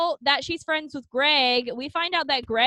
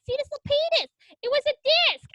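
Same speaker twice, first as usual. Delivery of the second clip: put-on lightly high, silly girl voice; high mock voice; 11 semitones higher, much faster than usual. Friend retelling the heroine's line, high mock voice. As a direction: pitch it up like a ditzy teen. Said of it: "And she was like".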